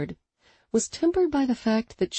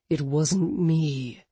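slow growl; 2 semitones lower, much slower than usual. Acted. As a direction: draw it out with a growl. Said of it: "Hunter growled".

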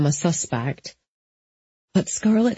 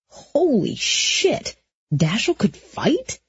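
slow, higher, amazed; astonished; 5 semitones higher, slower than usual. A narrator's reading. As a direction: stretch it out in disbelief. Astonished inner thought.

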